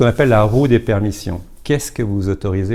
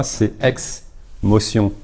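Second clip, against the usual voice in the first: ex-motion said slowly, ex split off and stressed; stressed word split; much slower than usual. Shown as a demonstration. Coach explaining the word to the audience, ex-motion split and stressed. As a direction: draw out ex-motion, leaning on ex. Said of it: "Ex, ça veut dire mouvement".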